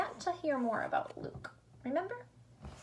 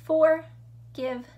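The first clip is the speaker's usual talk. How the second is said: "Forgiven" slow and loud, for, give, en, with gaps; chunked into syllables; 2 semitones higher, 7 dB louder, much slower than usual. Shown as a demonstration. Teacher counting syllables for pupils, "Forgiven" slowly chunked in three. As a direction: break "Forgiven" into three separate beats. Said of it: "how the word is chunked out".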